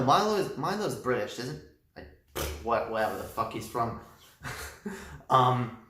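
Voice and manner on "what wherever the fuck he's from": usual speaking voice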